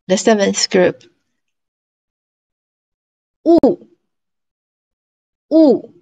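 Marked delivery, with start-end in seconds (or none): 3.4-5.9 u held high and loud; high tone demo. Shown as a demonstration. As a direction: sing "u" high and level. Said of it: "The second one".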